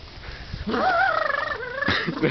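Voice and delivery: high laugh. Real, not acted. Friend laughs at drunk driver.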